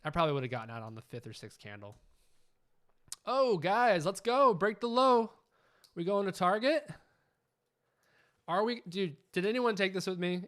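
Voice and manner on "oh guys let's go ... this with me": loud, high, cheering the trade on; yelling with real excitement as the price breaks; excited shout. Real, not acted. Trade running toward target live.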